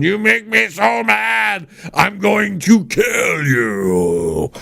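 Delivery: hammed-up parental threat, drawn out; mock threat. Parent threatening an out-of-line kid, mock menacing and slow. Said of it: "threaten them a little bit and going".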